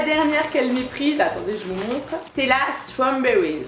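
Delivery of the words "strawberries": English accent attempt. Shown as a demonstration. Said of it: "mon accent anglais, ça fait peur".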